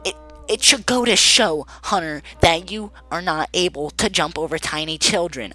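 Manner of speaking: a hint of a prim scolding teacher; mock the teacher's lecturing; lightly voiced teacher. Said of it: "she's all like".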